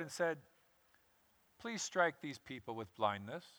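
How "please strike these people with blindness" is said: lower, softer, utterly unbothered prayer; calm as a cucumber. Acted. Elisha calmly prays while armies surround him: soft and unbothered. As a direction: ask it as if it's nothing. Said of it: "calm as a cucumber".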